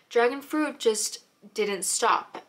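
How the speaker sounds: ordinary talk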